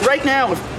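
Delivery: ordinary talk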